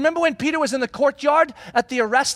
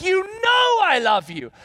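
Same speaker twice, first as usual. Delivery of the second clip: higher, slow, hurt and exasperated; exasperated Peter; 8 semitones higher, slower than usual. Retold or imitated. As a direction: plead it like a wounded friend losing patience. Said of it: "He gets mad and he's like".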